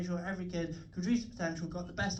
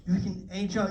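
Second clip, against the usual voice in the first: ordinary delivery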